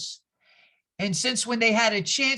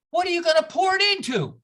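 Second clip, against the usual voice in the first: higher, louder mocking challenge; challenging shout; 6 semitones higher, 4 dB louder, faster than usual. Teacher challenging the fleeing peasants, loud and mocking. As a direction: fire the question at them.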